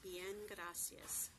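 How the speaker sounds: "Bien, gracias" said very slowly and clearly; slow model phrase. Shown as a demonstration. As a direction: spell it out with your voice. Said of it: "pronounced exactly as it's spelled".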